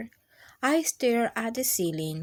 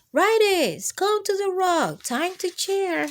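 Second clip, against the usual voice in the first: high, louder teacher call to the class; 9 semitones higher, 5 dB louder than usual. Acted. Teacher calling writers to the rock, high and loud. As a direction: summon the whole room cheerfully.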